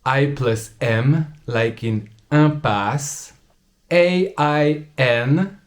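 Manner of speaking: vin, impasse, train, essaim, peindre, parfum said slowly with a nasal vowel; slow textbook pronunciation. Shown as a demonstration. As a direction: nasalize each example word slowly. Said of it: "makes the sound un".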